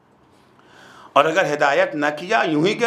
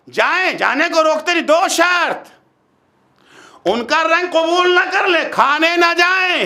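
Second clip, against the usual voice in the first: high, insistent sermon delivery; forceful preaching; 9 semitones higher, 4 dB louder than usual. Real, not acted. Preacher setting conditions for going, forceful preaching. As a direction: lay down the conditions emphatically.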